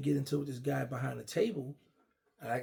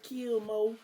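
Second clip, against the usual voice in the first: higher, heated street voice; young hothead voice, pitched up, demanding the knife; DC youngster talk; 8 semitones higher than usual. Retold or imitated. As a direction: mimic a hot-headed DC youngster. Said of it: "That's how the D C, uh, youngsters talk".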